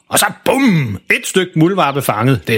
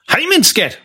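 called out; 13 semitones higher than usual. Acted. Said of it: "råber faren tilbage".

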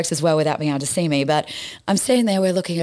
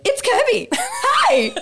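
very high-pitched, laughing mock yell; crazy girl yelling; much slower than usual. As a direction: holler it as a hammed-up crazy fan. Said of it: "you're that crazy girl yelling".

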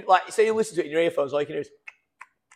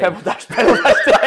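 laughing; 5 semitones higher, 8 dB louder than usual. Real, not acted. Laughing at friend's phrase.

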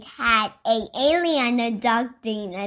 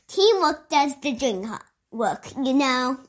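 ordinary delivery, nothing marked

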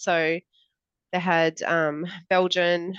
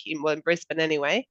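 ordinary talk, nothing marked

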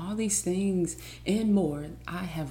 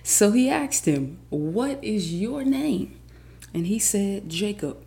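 loud, rising proclamation of the verse; dramatic Bible reading with building fervor; emphatic scripture reading; 2 semitones higher, 4 dB louder than usual. Acted. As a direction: stretch the questions and punch each phrase. Reads the Bible passage aloud.

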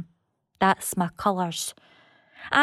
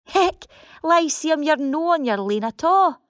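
sudden realisation, pitched up; surprised; 7 semitones higher than usual. Acted. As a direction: catch yourself mid-thought, startled. Sudden realisation addressing the theatre.

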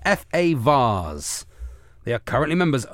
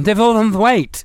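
blurted panicky name, higher; panicked spy; 9 semitones higher, 6 dB louder, slower than usual. Retold or imitated. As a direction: caught out and panicking. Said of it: "he's realised he's given him his proper name".

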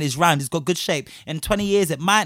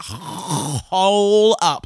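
louder, drawn-out objection; taken aback; 3 dB louder than usual. Retold or imitated. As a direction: stop them in their tracks. Cutting someone off in retelling.

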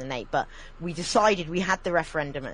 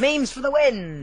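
louder, higher drawn-out cheer; hammed-up victory cry; mock rallying cheer; 7 semitones higher, 6 dB louder, slower than usual. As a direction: cheer it like a triumphant slogan.

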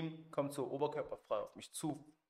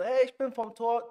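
higher and louder, calling through the gate; 9 semitones higher, 8 dB louder than usual. Retold or imitated. Visitor at the closed gate calling to the house, raised voice. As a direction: shout a cheery hey toward the house.

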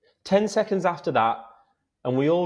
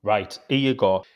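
ordinary talk, nothing marked

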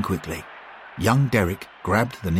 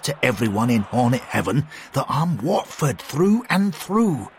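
higher exasperated man; exasperated McBain; 5 semitones higher than usual. A narrator's reading. McBain, exasperated, scolds Derek and Bill. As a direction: scold the fools.

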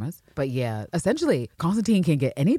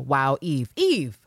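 ordinary delivery

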